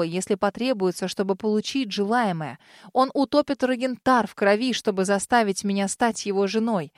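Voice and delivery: plain reading voice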